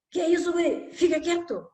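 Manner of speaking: high stern rebuke; scolding father. Retold or imitated. Disapproving father silencing crying son, stern and high. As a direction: cut the kid off sharply.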